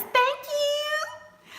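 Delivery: very high, drawn-out rising coo; gushing thanks. Real, not acted. Streamer is told her glasses are loved, gushing thanks. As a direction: squeak out delighted thanks.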